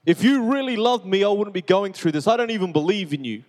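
yelling out to God in the backyard, anguished; retold yell at God. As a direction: shout it up at God in despair. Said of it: "I remember yelling out".

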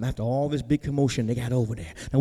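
ordinary delivery

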